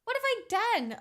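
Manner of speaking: hammed-up wounded innocence; mock outrage. Being told to research.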